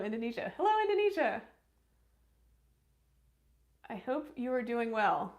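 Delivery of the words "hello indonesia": big, high, loud hello to the fans; a bright call to Indonesian viewers on request; excited greeting. Shown as a demonstration. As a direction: shout it out cheerfully like a host. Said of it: "Can you say hello, Indonesia?".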